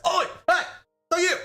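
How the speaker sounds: high-pitched mock bark; drill instructor. Drill instructor spotting a recruit's stare, mock yell. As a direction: imitate a drill instructor hollering. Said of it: "drill instructor, of course, sees this and says".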